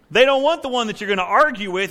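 usual speaking voice

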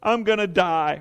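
high, whiny mock Rachel; mock distressed Rachel pleading with Jacob; pity party whine. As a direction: whine it like a manipulative wife. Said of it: "it's a pity party".

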